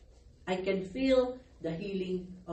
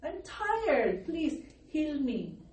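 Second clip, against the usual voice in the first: retold pleading prayer; 9 semitones higher than usual. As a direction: re-enact begging the Lord, high and weary. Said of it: "He answered my prayer".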